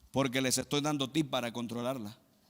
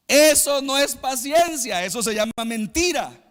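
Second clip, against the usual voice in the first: forceful rebuke; 8 semitones higher, 7 dB louder than usual. Acted. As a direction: thunder it from the pulpit. Preacher rebukes false patience. (el predicador reprende con fuerza)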